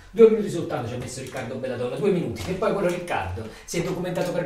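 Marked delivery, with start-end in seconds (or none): none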